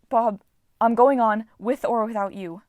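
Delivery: resolute ultimatum; determined. A narrator's reading.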